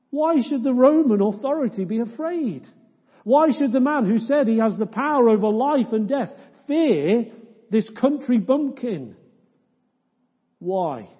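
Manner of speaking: full-force preaching. Real, not acted. Preacher challenging the congregation, fervent. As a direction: hurl the question at the room.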